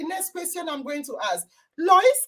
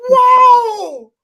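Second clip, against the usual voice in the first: loud, higher burst of wonder; amazed wow; 5 semitones higher, 11 dB louder than usual. Real, not acted. Host hearing the repeated definition, loudly amazed. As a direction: let out a big astonished wow.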